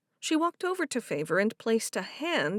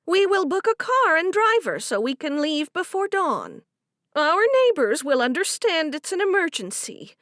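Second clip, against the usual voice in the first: high, loud and fussing; motherly older woman; 8 semitones higher, 7 dB louder than usual. A narrator's reading. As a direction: bustle in to take charge. Harriet's voice.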